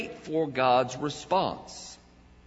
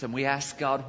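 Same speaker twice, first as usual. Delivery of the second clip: normal talking voice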